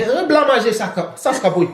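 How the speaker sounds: high-pitched mock French babble. Joking about speaking in Haiti, mock French. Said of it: "Just walk around, be speaking".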